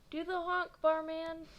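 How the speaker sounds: high, loud cheer in a different voice; crowd shout. Acted. A kid yells to Mars, loud and high. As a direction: holler it across the street.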